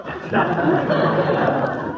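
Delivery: airy high-pitched titter; high giggle. Acted. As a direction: laugh under your breath at the kids. Uncle chuckles at war game.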